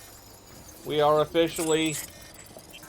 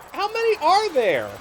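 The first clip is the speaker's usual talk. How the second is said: higher, louder amazed repeat; louder disbelief; 8 dB louder than usual. Real, not acted. Gamer repeats the question at the swarm, louder amazed yell.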